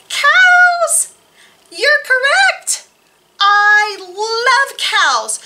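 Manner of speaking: high, loud, delighted cheer; thrilled librarian reveals the answer; excited reveal. Real, not acted. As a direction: announce the answer with big excitement.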